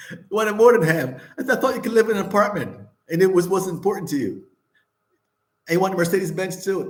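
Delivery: real laughter breaking through the complaint; laughing. Real, not acted. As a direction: laugh in disbelief while calling her out. Laughing at ex-wife's hypocrisy.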